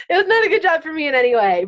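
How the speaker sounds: high, loud, tearful words; voice breaking. Real, not acted. Guest telling her story as tears rise, voice breaking. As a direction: let the real crying break through.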